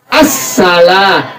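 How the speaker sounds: "assalah" slow, the s held double; doubled s. Shown as a demonstration. Teacher stressing the double s for students. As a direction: hold the s in "assalah" long and slow. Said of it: "Double tuh S-nya".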